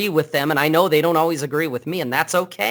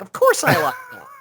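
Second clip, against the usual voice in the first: hammed-up indignant yell of a friend; mock indignant shout. Joking podcast host shouts his friend's loyalty, hammed-up. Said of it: "It's like".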